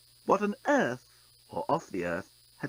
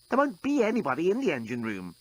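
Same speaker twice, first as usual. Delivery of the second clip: explaining; 5 semitones higher than usual. A narrator's reading. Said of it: "explained Scraliontis".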